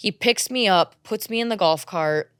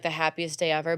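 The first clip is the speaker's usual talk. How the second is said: ordinary talk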